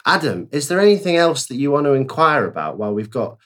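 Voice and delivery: normal talking voice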